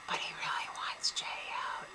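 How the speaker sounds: very soft whispered aside; conspiratorial whisper. Acted. Host revealing Adam's real plan, secretive whisper. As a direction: whisper the secret behind his back.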